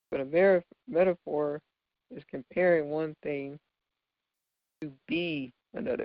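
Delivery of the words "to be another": usual speaking voice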